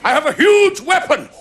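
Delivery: hammed-up royal boasting, pitched high; boastful pretender king. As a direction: proclaim it like a swaggering would-be king. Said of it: "He used to go around saying".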